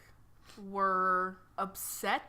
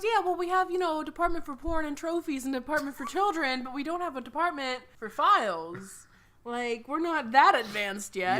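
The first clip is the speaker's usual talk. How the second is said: a hint of a clerk voice; 6 semitones higher than usual. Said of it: "he goes".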